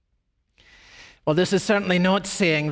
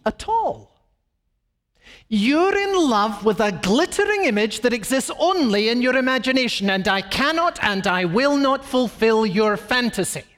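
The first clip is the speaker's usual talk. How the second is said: indignant woman; 6 semitones higher than usual. Acted. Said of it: "she will say".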